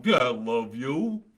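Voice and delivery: slow, drawn-out sarcasm; mocking, insincere love; sarcastic. Shown as a demonstration. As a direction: drag it out as if you don't mean it. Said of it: "maybe I'm being sarcastic going".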